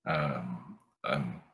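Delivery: low, quiet, hesitant refusal; reluctant man. Acted. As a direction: back out softly with halting pauses. Man awkwardly declines the invitation.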